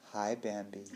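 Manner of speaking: mock cooing greeting. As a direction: greet a wild animal very softly, playfully. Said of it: "Not quite in that tone of voice".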